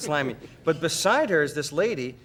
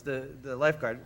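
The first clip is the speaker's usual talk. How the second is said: ordinary talk, nothing marked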